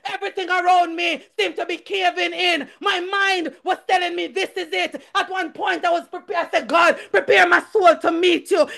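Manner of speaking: high-pitched, urgent preaching; impassioned church testimony; fervent testimony. Real, not acted. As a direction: testify with rising fervour to the congregation.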